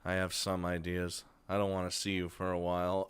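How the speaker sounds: Rin, quietly setting a limit. Acted. Voicing Rin's reply.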